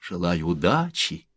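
ordinary delivery

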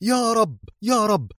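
high-pitched plea to God; crying out. A narrator's reading. Woman running to the silo, crying out in prayer. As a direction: exclaim a desperate prayer, pitch soaring. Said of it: "والأخيرة تهتف".

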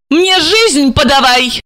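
high-pitched, drawn-out demand; angry woman cutting in, pitched high; cross outcry. A narrator's reading. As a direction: cry out crossly without much volume. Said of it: "закричала она".

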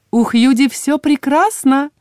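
high, slow, lilting reassurance; cooing. A narrator's reading. Healer reassures visitors about patient, slow cooing. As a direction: coo it airily, drawn out. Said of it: "проворковала целительница".

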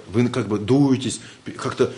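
ordinary talk, nothing marked

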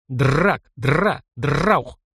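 voiced rattling triple gun burst; gunfire bursts. A narrator's reading. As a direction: stutter out rapid shots. Three bursts at the eyes.

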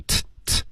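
"t" as a bare puff, no vowel; voiceless t. Shown as a demonstration. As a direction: imitate a learner's "t" without throat vibration. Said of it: "所以嗓子没震动".

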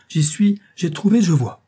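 very high and loud, rising on suis; triumphant exclaiming. A narrator's reading. Couturier crowing his inspiration to the guests, very high and loud. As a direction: crow with triumph. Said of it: "s'écria-t-il enfin avec un geste grandiose".

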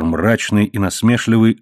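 ordinary delivery, nothing marked

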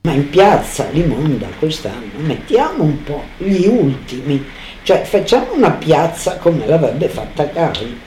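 ordinary delivery

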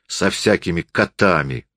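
ordinary delivery

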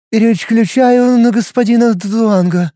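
high, slow, tongue-tied woman; faltering babble. Acted. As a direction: she stumbles over the words, flustered. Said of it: "заплетающимся голосом пролепетала".

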